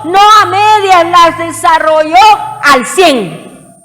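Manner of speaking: full-force preaching. Acted. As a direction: preach it out, rising to the congregation.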